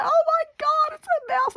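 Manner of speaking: very high, panicked squeal; frightened shriek. Retold or imitated. Mouse runs straight at her, panicked high shriek.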